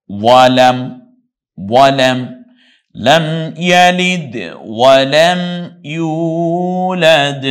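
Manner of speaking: ইউলাদ with ইউ stretched very long. Shown as a demonstration. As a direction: draw out ইউ in ইউলাদ, loud and high. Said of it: "ইউ টানলাম কেন". (ইউলাদ-এ ইউ অনেক টেনে)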